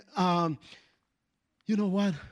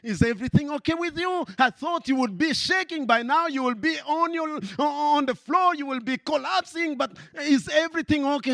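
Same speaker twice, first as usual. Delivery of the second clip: higher, louder worried questions; lightly voiced prison keeper; 10 semitones higher, 3 dB louder than usual. Prison keeper asking the prisoners, raised worried voice. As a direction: ask anxiously why they're not shaking. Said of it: "they start shaking and go".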